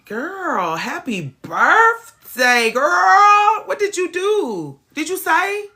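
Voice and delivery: high, loud birthday greeting; excited greeting. Real, not acted. Greeting a friend on her birthday, excited.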